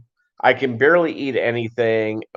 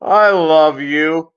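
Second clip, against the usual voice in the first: slow, louder mock yell of a drunk buddy; imitated drunken yell; 5 semitones higher, 5 dB louder, much slower than usual. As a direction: draw the words out slowly, raised and mock-hollered. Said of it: "I hear my buddy yell out".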